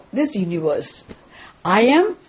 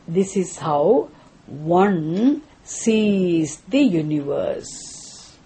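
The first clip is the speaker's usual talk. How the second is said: slow, deliberate emphasis; 4 semitones lower, 4 dB quieter, much slower than usual. Shown as a demonstration. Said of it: "slow emphatic teaching".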